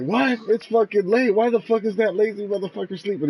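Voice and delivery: put-on old man demanding why the grandson is still in bed, angry; angry grandfather. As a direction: bark it out like an annoyed old man. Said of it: "He was pissed off".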